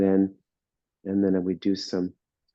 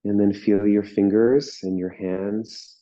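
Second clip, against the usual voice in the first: guided meditation; 3 semitones lower than usual. Real, not acted. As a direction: ease them into stillness, unhurried.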